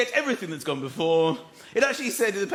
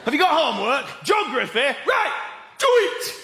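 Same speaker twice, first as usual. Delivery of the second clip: stern dad; 6 semitones higher than usual. Retold or imitated. Dad telling his son to do his homework, bossy. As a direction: order the kid about. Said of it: "So he goes".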